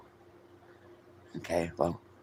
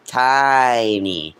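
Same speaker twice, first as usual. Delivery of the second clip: goofy voice. Shown as a demonstration. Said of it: "making her sound like Goofy".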